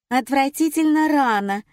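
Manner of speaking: high, loud petulant whine; whiny spoiled pouty drawl. Acted. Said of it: "закапризничала Светлана". (капризным, ноющим голосом)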